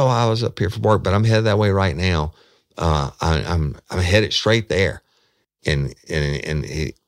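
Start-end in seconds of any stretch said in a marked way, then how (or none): 0.0-5.0 higher and louder, jittery and hurried; a hint of a keyed-up man brushing her off; amped-up man. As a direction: play him wired and evasive. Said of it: "really amped up".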